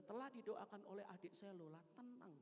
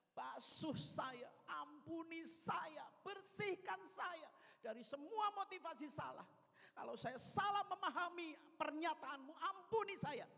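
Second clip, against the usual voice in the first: fervent prayer; 6 semitones higher, 7 dB louder than usual. Retold or imitated. Earnest prayer for forgiveness.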